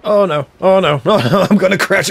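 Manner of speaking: high, loud, rising panic; panicking as the ship crashes; scared horror game streamer. Real, not acted.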